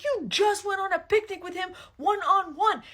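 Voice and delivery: high, loud, slow accusing whine; hammed-up husband throwing it back at her; mock jealous husband. Husband accusing his wife on the plane, hammed-up high and loud.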